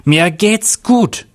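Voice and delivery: high, drawn-out mild yell; shouted after them. A narrator's reading. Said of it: "schrie Sabrina den zwei Kommissaren hinterher".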